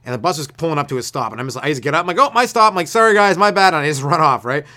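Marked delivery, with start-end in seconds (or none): 2.2-2.7 high, loud blurt; mock hasty announcement. Comedian re-enacting his escape from the bus, blurted. Acts hasty exit after spill. 2.9-3.7 loud, higher mock apology; called-out apology. Comedian re-enacting his exit after dousing passengers, loud apology. Acts quick apology while fleeing.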